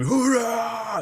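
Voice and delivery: high shouted hurrah; mock battle cry. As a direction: belt out Hurrah! like soldiers charging. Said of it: "they just scream".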